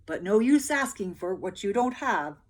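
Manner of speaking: loud, mock-wistful soldier; sighing soldier. A narrator's reading. Soldier slyly sighing to peasants. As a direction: sigh it out slyly. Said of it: "the soldier sighed".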